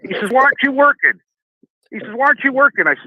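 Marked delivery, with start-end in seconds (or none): 0.3-1.2 high mock demand from the brother; mock bossy brother. Retelling the brother demanding why he isn't working, high and pushy. Brother barks at him at work. 2.1-2.9 high mock demand from the brother; mock bossy brother. Retelling the brother demanding why he isn't working, high and pushy. Brother barks at him at work.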